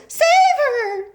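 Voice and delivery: very high, loud plea; a mother begging for help; desperate cry. A narrator's reading. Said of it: "cried Missus Beauregard".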